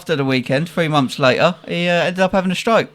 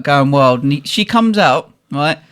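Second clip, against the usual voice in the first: ordinary talk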